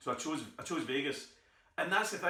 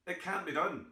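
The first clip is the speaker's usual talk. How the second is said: usual speaking voice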